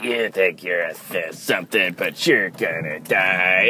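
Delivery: lightly voiced deep, gravelly wolf villain; a hint of a cheesy gravelly Bond-villain wolf. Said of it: "big, deep, gravelly voice".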